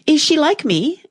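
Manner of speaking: put-on Alice hoping for another girl, eager; eager question. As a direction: lean in, eager to know. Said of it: "Alice asked eagerly".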